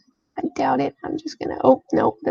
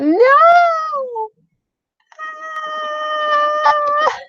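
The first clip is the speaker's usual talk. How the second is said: long, high drawn-out wail; high-pitched howl of protest; dismayed wail; 4 dB louder than usual. Real, not acted. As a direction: cry out in hammed-up dismay. Wails at major character death tag.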